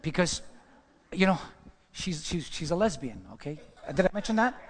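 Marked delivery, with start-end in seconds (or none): 3.9-4.6 chuckling through the words; laughing aside. Real, not acted. Preacher laughing to the congregation about her. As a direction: let the laugh spill into the reveal. Laughs while revealing detail.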